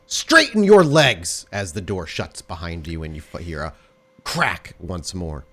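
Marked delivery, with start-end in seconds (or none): 0.1-1.5 sharp, high, very loud drawn-out command; shout. Acted. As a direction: bellow it like a strict dance teacher. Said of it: "you hear her simply shout".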